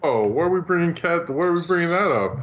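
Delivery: ordinary talk, nothing marked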